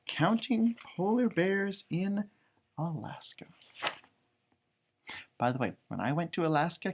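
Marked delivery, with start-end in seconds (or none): 0.1-3.5 a hushed whisper of the title; whispered for suspense. Acted. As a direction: drop to a whisper for mystery. Whispering the book title dramatically.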